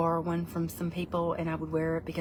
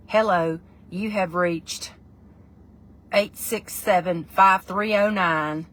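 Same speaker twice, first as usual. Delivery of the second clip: slow, loud, drawn-out country drawl; country answering-machine greeting; 6 dB louder, much slower than usual. Shown as a demonstration. Recording mama's answering machine, slow country greeting. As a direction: stretch each word in a country accent. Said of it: "that's what it sounded like".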